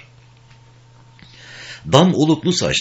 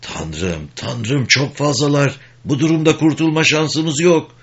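moaning in dread; 7 semitones higher than usual. A narrator's reading. Garrick watching the enemy come closer, moaning in dread. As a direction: moan it, rising towards despair. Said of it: "yaklaşmasını seyrederken inledi".

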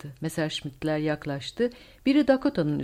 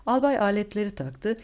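ordinary delivery

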